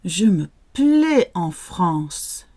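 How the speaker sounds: slow, careful French teacher model; slow model phrase. Shown as a demonstration. Teacher slowly modelling a phrase for learners. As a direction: say it slowly so learners can repeat. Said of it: "say it nice and slowly first of all".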